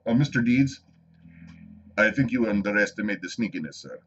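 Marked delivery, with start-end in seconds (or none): 2.0-4.0 a lightly voiced film character, a little lower and softer; movie line quote. Quoting Mister Deeds to chat in a mild put-on voice. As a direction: toss off the line like a deadpan movie butler. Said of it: "What was that movie?".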